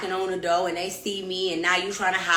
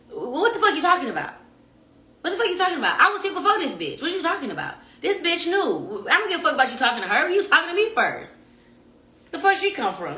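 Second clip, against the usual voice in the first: indignant high-pitched tirade at the ex; furious rant; 6 semitones higher, faster than usual. Real, not acted. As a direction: go off on him, outraged. Angry retort to ex.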